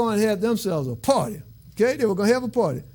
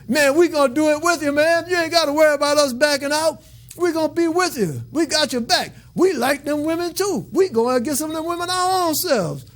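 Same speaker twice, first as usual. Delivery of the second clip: higher, loose street slang voice; street-talk angel buddies; 7 semitones higher than usual. Retold or imitated. Preacher retelling the angels backing their leader, eager slangy buddies. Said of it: "I just try to interpret that".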